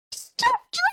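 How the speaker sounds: very high, gleeful squeal; high happy shriek; excited squeal. Retold or imitated. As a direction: squeal with delight at the winner. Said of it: "and I was like".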